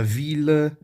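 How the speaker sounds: "vila" said as French "ville", with a clear L; French exception, L sounded. Shown as a demonstration. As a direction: say "vila" with the L pronounced and a faint final vowel. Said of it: "E aí temos exceções".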